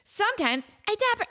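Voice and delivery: high, piping imitation of her young self; squeaky kid-voice imitation; high annoying voice. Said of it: "my voice was so high and annoying".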